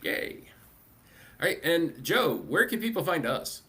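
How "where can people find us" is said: usual speaking voice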